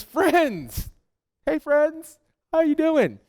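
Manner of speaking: high, sing-song friendly call; playful Jesus hailing friends; teasing call. Acted. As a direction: call out cheerfully to the boat.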